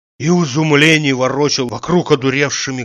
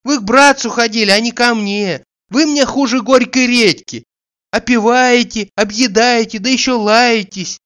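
high-pitched, lightly plaintive female figure; scolding hostess; 8 semitones higher, 3 dB louder than usual. A narrator's reading. Hostess telling off a freeloading guest, high scolding voice. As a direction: reel off complaints in a high voice.

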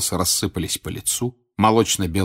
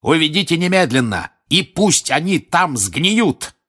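loud, higher spitting order; furious shout; 9 semitones higher, 4 dB louder than usual. A narrator's reading. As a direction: spit the order out in rage. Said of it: "крикнул он дементорам, брызжа слюной".

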